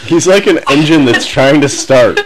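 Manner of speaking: loud, talking through giggles; laughing. Real, not acted. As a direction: fight back laughter as you speak. Group laughing fit.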